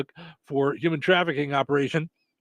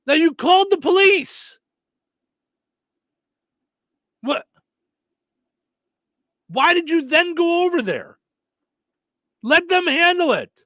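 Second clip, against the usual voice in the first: high, loud questioning cop; police officer voice; 10 semitones higher, 4 dB louder than usual. Retold or imitated. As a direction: play the puzzled officer. Host voicing the police officer.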